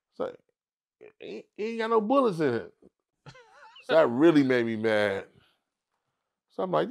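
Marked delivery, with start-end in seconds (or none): none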